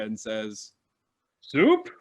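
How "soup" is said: much higher, teasing single word; smug bright soup offer with a grin; sing-song teasing question; cheeky offer. Acted. Nossix smiles and offers again.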